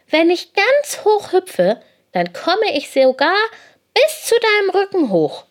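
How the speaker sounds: high, loud, excited little hare; eager boast. A narrator's reading. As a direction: boast proudly in a high storybook voice. Little hare boasting to big pony.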